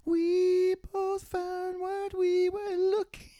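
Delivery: put-on high slow child singing; kid voice. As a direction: croon high like a young boy. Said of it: "let me do the kid voice".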